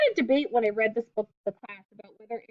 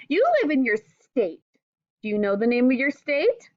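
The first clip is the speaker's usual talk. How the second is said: clear, gentle read-aloud to a class; reading to children; 5 dB louder than usual. Acted. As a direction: read the book aloud to young pupils, patiently. Teacher reads picture book to class.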